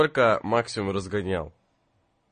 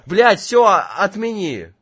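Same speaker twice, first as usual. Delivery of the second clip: flustered outburst; 8 semitones higher, 8 dB louder, slower than usual. Acted. Flustered attempt to cancel.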